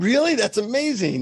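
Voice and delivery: high, impressed "no way" reaction, acted; amazed reaction. Telling how he answered his transformed friend, amazed.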